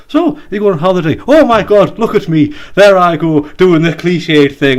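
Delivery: chatty dentist. Retold or imitated. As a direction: gush like a giddy dentist.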